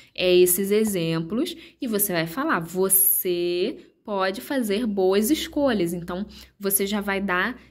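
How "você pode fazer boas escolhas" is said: emphatic encouragement. Shown as a demonstration. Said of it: "uma ênfase ali falando".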